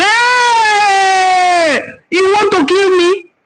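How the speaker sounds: parents' horrified cry. Retold or imitated. Parents hear the girl's community, wail in horror. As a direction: wail as if the news could kill you. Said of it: "They say".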